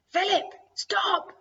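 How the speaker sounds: high, loud, drawn-out shout; distressed, urgent yell; crying out. A narrator's reading. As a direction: cry out desperately to halt him. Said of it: "I cry out".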